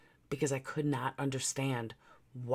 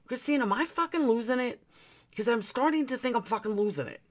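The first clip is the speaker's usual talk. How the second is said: retold desperation; 9 semitones higher than usual. Asking neighbor Christine if she's losing it, high anxious plea. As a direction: ask your best friend, half-panicked, pitched up.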